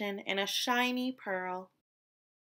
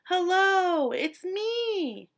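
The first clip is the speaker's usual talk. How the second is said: high, louder eager call; 9 semitones higher, 4 dB louder, much slower than usual. A narrator's reading. Fish calling to what he thinks is a friend. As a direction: call out to the shimmer ahead. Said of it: "he called".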